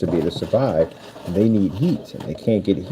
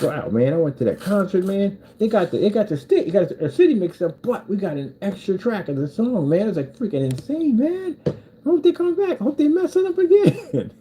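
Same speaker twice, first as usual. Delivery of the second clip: excited concertgoer; 13 semitones higher than usual. Acted.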